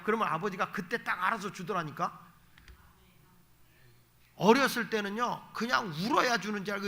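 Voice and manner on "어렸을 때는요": usual speaking voice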